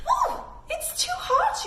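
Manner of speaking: very high, slow pretend yelp; hammed-up too-hot squeal; mock ouch. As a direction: squeal as if the tray burned you. Pretend burning on hot cookies.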